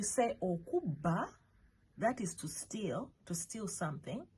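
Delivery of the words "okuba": okuba with a hard, held double B; okuba, strong doubled b; emphasized double B. Shown as a demonstration. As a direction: stress the B in okuba, closing firmly before "ba". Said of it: "double letters to emphasize".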